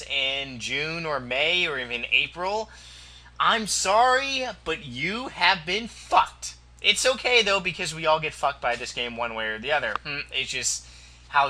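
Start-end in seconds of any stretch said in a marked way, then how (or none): none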